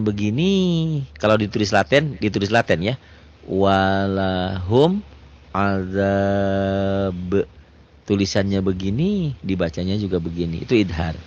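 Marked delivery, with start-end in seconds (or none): 3.5-7.5 slow, melodic tajwid chanting; chanted Quranic reading; Quran recitation. Shown as a demonstration. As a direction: recite it slowly with drawn-out vowels. Recites example slowly.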